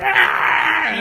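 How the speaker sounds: high, loud pained gremlin shriek; cartoonish creature yelp, very high and loud; gremlin scream. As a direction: scream as acid hits the face. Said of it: "And the gremlin goes, like".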